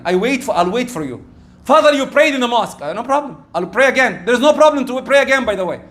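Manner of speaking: ordinary delivery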